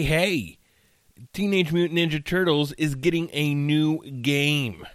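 ordinary talk, nothing marked

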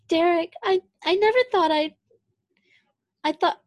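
high, breathy tearful voice; crying softly. A narrator's reading. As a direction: choke it out through tears. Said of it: "crying softly, crying voice muffled".

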